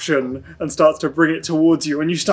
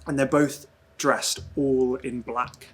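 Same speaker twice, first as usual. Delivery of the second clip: dreamy scene narration. Acted. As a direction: draw them into an eerie dream.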